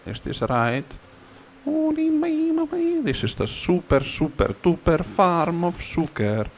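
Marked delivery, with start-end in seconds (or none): 1.6-6.5 high, giddy burst of delight; excited squeal. Real, not acted. Gamer squealing happily at his sugar farm. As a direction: squeal with joy at the new farm.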